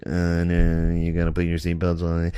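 muffled mocking announcer voice; airline announcer. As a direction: imitate a tedious flight attendant on the PA. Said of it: "They're like".

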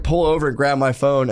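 normal talking voice

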